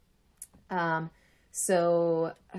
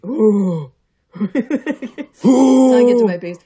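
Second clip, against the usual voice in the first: movie trailer voice; 4 semitones higher, 14 dB louder than usual. Pretend. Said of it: "I have to get to the base of my voice".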